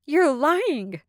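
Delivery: high, sharp accusation; called-out accusation. Acted. Soraya accusing Kelsey before the court, high and sharp. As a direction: throw the accusation at him. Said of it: "Soraya called out".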